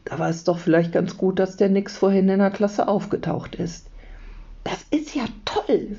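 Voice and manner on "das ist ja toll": high-pitched, wowed exclamation; awed delight, pitched high; thrilled. A narrator's reading. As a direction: marvel at it, voice way up. Leo thrilled by the story.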